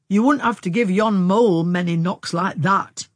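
loud, hearty tease; teasing. A narrator's reading. Young man back on safe ground with the younger sister, teasing. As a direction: rib her loudly. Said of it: "he teased".

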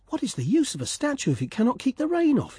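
high, indignant little swallow. Acted. Swallow grumbling at the statue, high and indignant. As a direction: huff it high and annoyed.

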